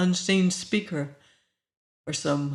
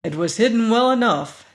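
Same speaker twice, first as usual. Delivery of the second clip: louder, higher, grim retort; 5 semitones higher, 6 dB louder than usual. A narrator's reading. Danton answers the woman about the hidden door, grim. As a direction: answer back with grim force. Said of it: "Danton told her grimly".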